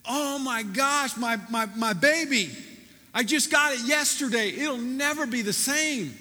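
high, loud panicked wail over the car; mock distraught, cradling his baby; freaking out. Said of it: "freaking out".

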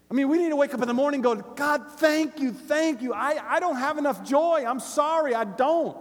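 impassioned preacher building on repetition; full-force preaching. Real, not acted. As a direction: pound out each repeated phrase to the congregation.

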